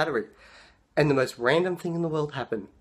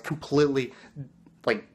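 normal talking voice

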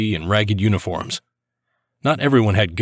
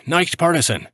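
high-pitched call at moderate volume; mild narrated shout; 8 semitones higher than usual. A narrator's reading. Civilians surrendering with hands up, restrained high shout. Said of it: "They were shouting".

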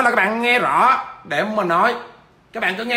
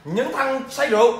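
normal talking voice

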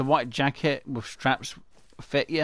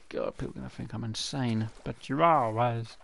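usual speaking voice

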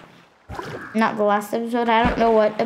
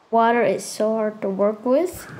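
normal talking voice

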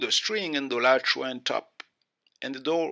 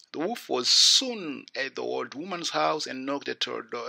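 ordinary delivery, nothing marked

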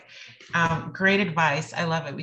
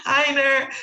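Heiner called out high and loud, laughing; laughing name; 9 semitones higher, 8 dB louder than usual. Real, not acted. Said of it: "that was my New England accent".

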